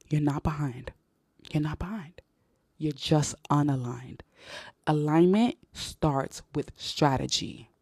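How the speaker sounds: slow, soft, deliberate words; said slowly. Shown as a demonstration. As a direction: lay each word down slowly and softly. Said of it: "I'ma say this slowly".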